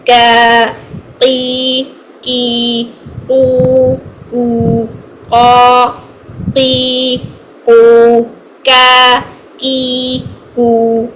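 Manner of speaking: क की कू in turn, short and firm; qaf vowel drills. Shown as a demonstration. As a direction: drill क की कू one by one. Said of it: "मद की हालत में". (क की कू की मश्क)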